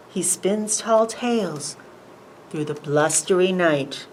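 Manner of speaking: plain reading voice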